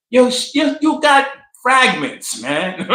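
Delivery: high incredulous jab ending in laughter; laughing disbelief. Real, not acted. Host scoffing at her story of bleeding, high and laughing.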